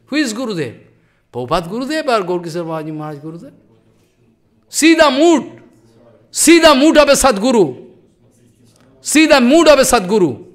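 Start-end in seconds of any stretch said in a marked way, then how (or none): 4.7-10.4 high, loud call to look; rising to full force; emphatic preaching. Real, not acted. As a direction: proclaim it to the hall. Preacher urging listeners to see.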